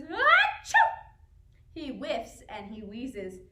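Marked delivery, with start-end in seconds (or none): 0.0-0.7 big high sneezing "Achoo"; loud sneeze. A narrator's reading. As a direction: sneeze it out hard. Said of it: "and he sneezes".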